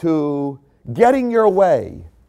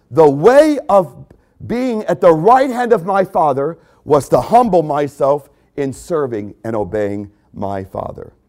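ordinary talk

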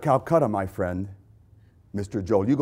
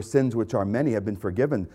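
ordinary talk

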